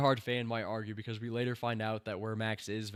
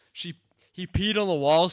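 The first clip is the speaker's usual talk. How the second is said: mock incredulous; 6 semitones higher, 10 dB louder than usual. Real, not acted. Mocking the line's logic.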